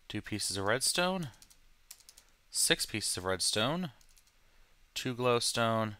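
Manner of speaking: normal talking voice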